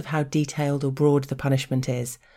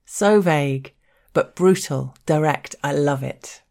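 usual speaking voice

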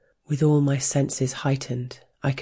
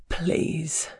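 weak cracking voice; 3 semitones higher, 3 dB quieter than usual. Acted. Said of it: "voice weak and cracking with the effort".

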